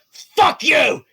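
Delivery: high furious shout at the knight; the shark roars its defiance; shark yelling. Acted. GM voices the shark cursing at Cyrus, shouting. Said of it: "He's like".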